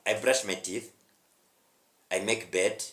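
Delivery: ordinary talk, nothing marked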